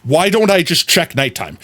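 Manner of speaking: ordinary talk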